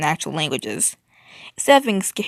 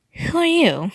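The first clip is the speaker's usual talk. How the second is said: high-pitched, irritated challenge; 10 semitones higher, 3 dB louder, slower than usual. A narrator's reading. Narrator questioning an unwelcome stranger, irritated. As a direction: demand it with an edge of irritation. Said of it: "an irritated scowl on my face".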